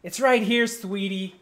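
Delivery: high, loud sweet barmaid. Acted. Barmaid serving a drink, sweet high call. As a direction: coo it at the customer like a barmaid. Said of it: "She goes".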